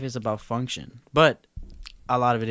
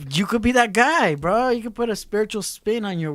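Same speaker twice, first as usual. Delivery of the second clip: higher and louder, pumping him up; excited hype; 9 semitones higher, 6 dB louder than usual. Real, not acted. Friend hyping up a metalworker buddy, excited and loud. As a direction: sell him on the dream, loud and bright.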